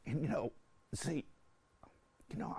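ordinary delivery

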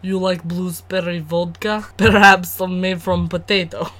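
put-on Russian; mock Russian accent. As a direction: offer vodka in cod Russian. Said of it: "You sound Russian".

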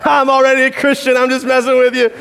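mock the preacher playfully; the friend's prank reveal, lightly voiced; teasing friend. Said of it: "he's like".

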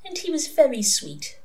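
high, dreamy reminiscence; wistfully. A narrator's reading. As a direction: recall it longingly, as if in a trance. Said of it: "said Mary Hodges wistfully".

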